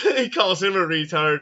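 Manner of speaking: high-pitched, laughing retelling; helpless amusement breaking into the words. Real, not acted. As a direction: crack up while telling it. Cracking up retelling film scene.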